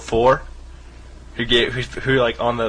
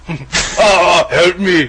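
higher strained grunting cry for help; louder strained mock grunt; grunt impression; 5 semitones higher, 5 dB louder, much slower than usual. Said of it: "grunt impression".

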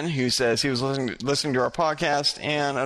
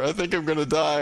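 ordinary delivery

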